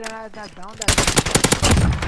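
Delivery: frustrated outburst. Real, not acted. Missed kill, frustrated outburst.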